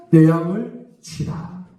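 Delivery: slow solemn Jesus. Retold or imitated. Jesus commands Peter.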